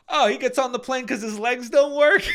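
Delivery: loud, high mock Italian accent; a hammed-up Italian guy blurting it out. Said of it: "I just hear this kinda Italian guy go".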